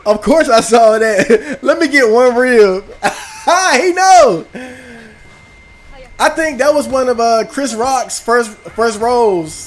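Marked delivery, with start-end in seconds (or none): 1.6-4.5 laughing quote. Retold or imitated. Gamer laughing as he recalls a movie line, laughing quote. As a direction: blurt the line through laughter.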